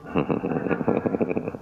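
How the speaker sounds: an amused unvoiced laugh; gentle laugh after the punchline. Real, not acted. After the monk story.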